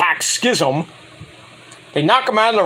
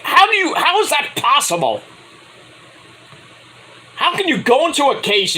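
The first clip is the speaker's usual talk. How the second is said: incredulous outburst; 7 semitones higher than usual. Real, not acted. Outraged at dismissed case.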